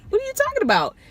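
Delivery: high, disbelieving question; incredulous. Retold or imitated. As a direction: ask it like you can't believe your ears. Shocked reaction to haunting claim.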